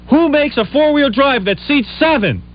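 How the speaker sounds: high, drawn-out exasperated demand; slow, high-pitched exasperation. Retold or imitated. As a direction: retell it as an incredulous challenge. Exasperated retort in retold argument.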